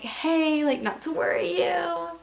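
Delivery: high, drawn-out singsong hey; mock-casual high voice, easing into bad news; casual reassurance. Calling mom about the accident, high careful reassurance.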